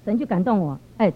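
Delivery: normal talking voice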